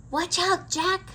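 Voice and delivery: high, loud call to run; urgent shout. A narrator's reading. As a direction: yell it like she's really scared. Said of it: "said Annie".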